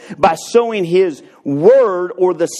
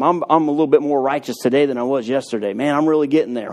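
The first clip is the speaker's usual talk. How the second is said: mock self-congratulation; 4 semitones lower than usual. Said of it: "You can't become".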